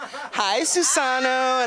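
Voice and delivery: high, slow sing-song greeting; secretary greeting a patient; flirty receptionist. Retold or imitated. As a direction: draw out his name sweetly.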